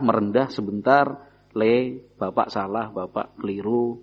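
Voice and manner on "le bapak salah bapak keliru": soft, slow father admitting fault; a quiet, tender apology to his son; humble apology. Retold or imitated. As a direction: speak gently, like a father giving in to his son.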